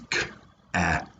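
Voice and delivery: "K-at" broken into soft, separate sounds; "K-at" softly segmented with gaps between sounds; sounded out. Shown as a demonstration. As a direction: tap out "K-at" sound by sound. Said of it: "If you tap it out".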